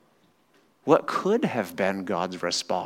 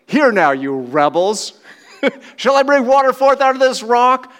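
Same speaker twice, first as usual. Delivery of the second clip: much higher, loud furious yell with a laugh breaking in; Moses losing it; 13 semitones higher, 11 dB louder than usual. Retold or imitated. Moses shouting at the rebellious people, furious and loud. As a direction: rage at the rebels like Moses at the rock. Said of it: "Moses loses it".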